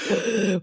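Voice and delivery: imitated shocked intake of breath; mock gasp of apprehension; mimicked gasp. Host mimics co-hosts' faces at her story, gasping. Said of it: "you were like".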